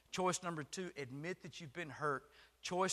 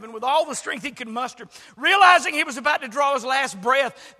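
normal talking voice

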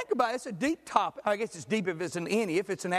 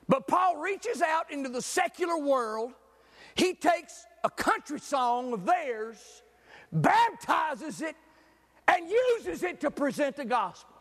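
preaching; 4 semitones higher, slower than usual. Acted. Preacher calling the congregation to reach out, rising sermon voice. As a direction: lean into the gospel point, drawn out.

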